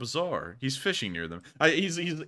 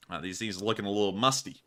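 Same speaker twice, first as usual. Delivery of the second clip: ordinary talk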